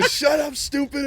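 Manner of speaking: high-pitched mock scream; hammed-up belligerent yell; imitated drunken scream. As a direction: holler it like a wasted bandmate. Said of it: "he's just screaming".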